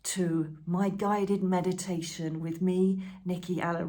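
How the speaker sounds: slow, soothing welcome of a meditation host; a light demo of her meditation voice; guided meditation voice. Shown as a demonstration. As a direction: ease the listener into a session. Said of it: "but I'm talking like this".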